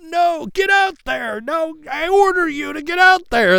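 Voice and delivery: high, loud barked command; commanding shout. Retold or imitated. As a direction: order them out onto the field. Drill sergeant ordering recruits to battle.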